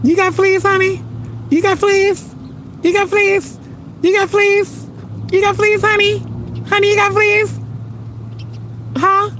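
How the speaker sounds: very high squeaky pet voice; teasing falsetto baby talk. Pretend. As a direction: coo it in a tiny high-pitched voice. Baby-talking to a pet bird.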